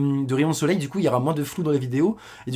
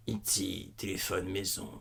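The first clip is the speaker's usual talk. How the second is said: low, soft creaky alien voice; E.T. impression; 7 semitones lower, 14 dB quieter, much slower than usual. Scaring classmates as E.T., low alien croak. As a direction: drop down low and slow like E.T. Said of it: "J'étais là, genre".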